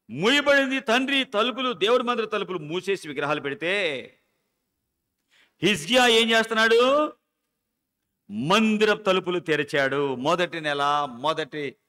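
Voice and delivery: loud, high fervent preaching; preaching at full force. Acted. Preacher to the congregation about the temple doors, fervent loud preaching. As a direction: preach it out, rising and ringing.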